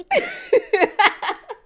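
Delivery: high, loud burst of laughter; big high-pitched cackling laugh. Real, not acted. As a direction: burst out laughing at your own wry remark. Laughs after wry denial of experience.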